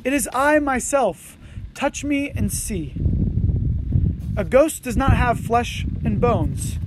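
measured, unhurried Bible reading; scripture reading. Acted. Pastor reading Luke to the congregation, measured and solemn. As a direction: read the passage out to the congregation with care.

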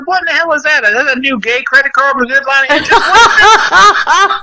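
high, rising mock outrage, breaking into a laugh; mock disbelief. Retold mocking reply in bar.

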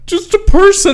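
very high, loud squeak; squeaky voice. Acted. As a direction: squeak it like a cartoon.

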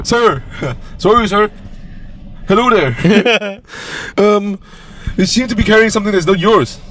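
officer politely stopping the robber; polite cop. Retold or imitated. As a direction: play a very polite Canadian cop. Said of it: "police comes over".

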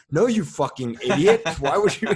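mock scolding. Friend retelling being scolded over a board game move, mock angry. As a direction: imitate an exasperated game teacher berating a beginner.

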